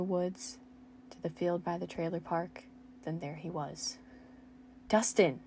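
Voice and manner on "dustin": put-on loud cry of the boy's name; scream. Steve spotting Dustin in the field, loud scream. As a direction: call out his name in alarm. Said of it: "Steve screamed".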